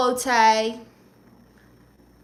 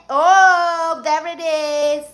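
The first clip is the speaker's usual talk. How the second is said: higher, louder delighted oh; excited reveal; 5 semitones higher, 7 dB louder, much slower than usual. Real, not acted. Streamer flexing biceps for chat, excited. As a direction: show off the muscle with glee.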